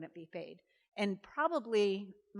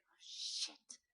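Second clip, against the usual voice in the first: breathless whispered oh shit under the breath; whispered curse; 10 dB quieter than usual. Retold or imitated. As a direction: mutter it so nobody hears, dismayed. Office worker cursing under breath.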